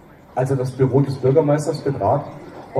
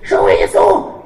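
shrill woman's call; 6 semitones lower, 8 dB louder, slower than usual. Acted. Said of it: "Die schrille Frauenstimme".

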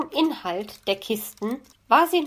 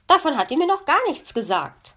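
high, loud and quick astonishment; astonished; 6 semitones higher, 5 dB louder, faster than usual. A narrator's reading. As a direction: blurt it out in amazement. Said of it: "war die Elster erstaunt". (erstaunt und schnell)

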